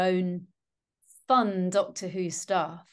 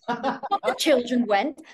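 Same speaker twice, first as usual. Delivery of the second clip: slow, high, loud mocking correction; sarcastic telling-off; 5 semitones higher, 6 dB louder, slower than usual. Fussy listener telling off radio actors, sarcastic. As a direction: scold them with exaggerated, drawn-out stress. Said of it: "like I'm telling them off".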